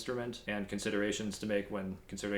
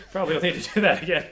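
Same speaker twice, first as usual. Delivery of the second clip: quick, louder, laughing through it; 5 semitones higher, 9 dB louder, much faster than usual. Real, not acted. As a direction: rush it out, cracking up. Laughing at his own slip.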